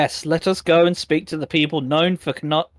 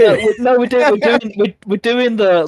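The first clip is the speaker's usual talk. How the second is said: whisper yelling; 7 semitones higher, 5 dB louder than usual. Pretend. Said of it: "the whisper yelling that couples do".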